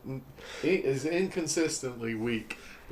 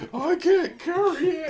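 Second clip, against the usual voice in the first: mocking Sam. Mocking the line again.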